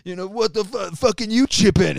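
angry troll jeering a broke musician; mocking online heckler. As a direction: mimic a rude commenter with scorn. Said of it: "Some motherfucker's like".

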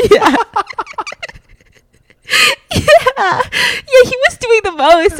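high, loud, half-crying with laughter; barely getting it out through laughs; words squeezed out between real laughs; laughing. Real, not acted. Laughing at her own story.

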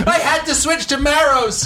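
high, slow, loud laughing shout; hammed-up shrill confession; squealing confession. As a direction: howl it out through laughter. Mock confession skit.

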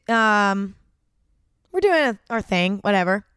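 normal talking voice

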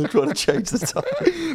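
giggling at the picture of zombies; laughing. Real, not acted. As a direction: laugh your way through it. Laughing at zombie pit crew.

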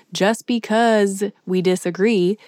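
slow, lightly drawn-out Texan imitation; a light imitation of his slow Texas delivery; slowed-down mock Texas drawl; Matthew McConaughey accent. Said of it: "I don't know why I said that in his accent".

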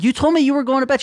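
high-pitched mock indignation; indignant protest. Grandson caught leaving grandma, indignant protest. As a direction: protest in disbelief, voice way up.

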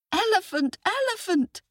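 put-on very high child's call; boy shouting. A narrator's reading. Boy calls the elephant for help, high-pitched shout. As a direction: squeal it as a small boy calling out. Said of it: "shouted the boy".

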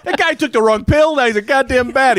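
high-pitched frantic report, acted; worked-up explanation. Witnesses tell hero about the mishap, high and worked up. Acting bystanders explaining the disaster.

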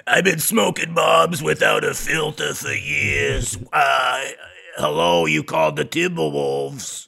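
loud gravelly chain-smoker rasp; raspy smoker voice. Pretend. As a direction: bark it like a lifelong smoker. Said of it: "not like".

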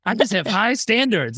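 high, mock-incredulous protest; indignant denial. Boss protesting to his laughing team, indignant.

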